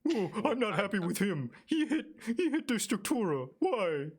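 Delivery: high whiny puppy voice; a fretful little dog talking. Acted. Dog character answers Layra.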